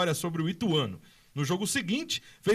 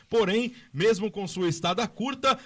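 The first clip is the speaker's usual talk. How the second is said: ordinary talk, nothing marked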